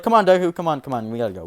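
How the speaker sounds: high, loud urging, then easing off; urgent loud call. Retold or imitated. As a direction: hurry him along, loud at first. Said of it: "Izumi's like".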